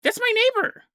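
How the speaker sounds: squeaky high, shocked cry; high mock exclamation. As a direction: blurt it out way up high like a spooked onlooker. Exclaims about neighbor in shock.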